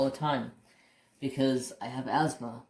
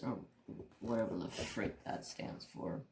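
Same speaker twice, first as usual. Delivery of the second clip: whispered aside; 4 semitones lower, 11 dB quieter than usual. Real, not acted. Dismissive aside about CSMs.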